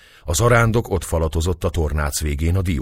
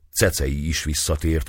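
plain reading voice

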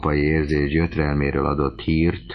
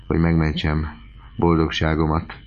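ordinary delivery, nothing marked

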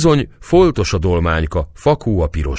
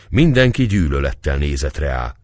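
plain reading voice

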